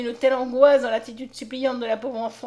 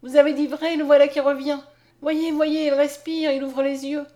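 high, loud, excited exclamations; crying out; 5 semitones higher, 7 dB louder than usual. A narrator's reading. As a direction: announce the revival urgently, voice raised. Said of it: "s'écria Mariette". (exclamations vives, voix haute et forte)

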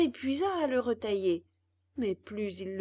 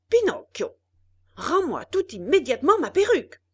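angry shout; 7 semitones higher, 11 dB louder than usual. A narrator's reading. Geppetto demands wig back.

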